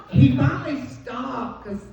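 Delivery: usual speaking voice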